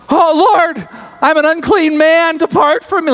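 high-pitched panicked plea; freaking out. Acted. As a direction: cry out to the Lord in acted panic. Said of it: "He's absolutely freaking out".

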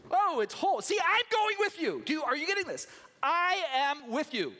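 high, emphatic, loud reassurance; excited God. Acted. God eagerly telling Moses he is with him, high and emphatic. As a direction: press the point home eagerly. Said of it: "God speaking to Moses".

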